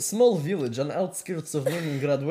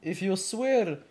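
put-on Russian accent with halting delivery; 2 semitones lower than usual. Podcast host welcoming listeners as Vladimir, Russian accent.

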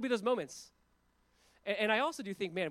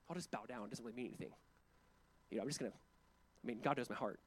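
mock self-talk; 6 semitones lower, 7 dB quieter than usual. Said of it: "the self-talk that would be happening in my mind".

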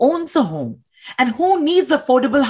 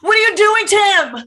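high, loud outraged demand; alarmed angry shout; 10 semitones higher, 4 dB louder than usual. Acted. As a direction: shout in urgent protest. Acted riot, protester confronts cop.

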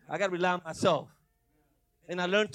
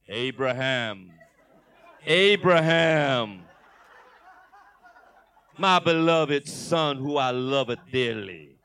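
slow, loud and lower; booming God voice; 4 semitones lower, 8 dB louder, much slower than usual. Retold or imitated. Pastor imitating the voice he hoped God would use, slow and booming. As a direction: speak like a gentle mighty God. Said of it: "I was expecting to hear".

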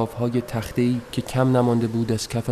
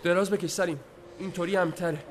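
higher voice urging him down; urgent instruction to Salim; 7 semitones higher, 6 dB quieter, faster than usual. Acted. The narrator urges Salim to lie down for safety. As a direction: tell him to get down, for his safety.

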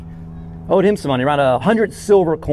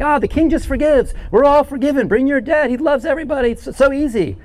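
higher, breezy mock of cheap grace; lightly lampoon the easy believer; mock carefree voice; 7 semitones higher than usual. Preacher mocking a too-easy view of forgiveness, breezy and higher.

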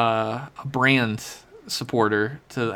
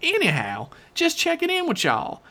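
folksy hillbilly letter-writer; 3 semitones higher than usual. Acted. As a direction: read it as a rambling backwoods yokel. Reads listener email in character.

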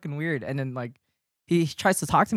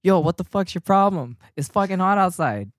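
retold angry outburst; 5 semitones higher, 5 dB louder than usual. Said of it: "So I'm like".

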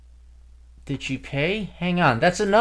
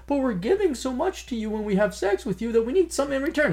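mock whiny woman; 12 semitones higher than usual.

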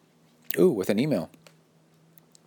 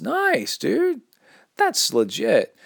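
excited; 14 semitones higher, much slower than usual. Real, not acted. Reacting excitedly to listener.

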